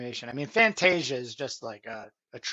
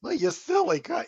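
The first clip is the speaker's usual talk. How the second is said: high lisping lion king voice; 11 semitones higher than usual. Retold or imitated. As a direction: mimic the king's lisp. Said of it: "Had a lisp".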